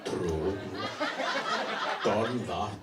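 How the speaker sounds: low, flat mock Finnish reply; deadpan Finn. Comic answering the book fair about her books in a low deadpan.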